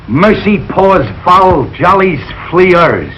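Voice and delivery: put-on "Merci pour vos jolies fleurs" dragged out slowly in a heavy American accent; butchered French. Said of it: "I know why you flunked French".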